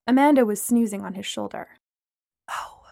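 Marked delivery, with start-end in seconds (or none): 2.5-2.8 a soft breathy whisper. Acted. Whispering oh so a sleeping friend stays asleep. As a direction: hush it under the breath. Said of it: "I whispered".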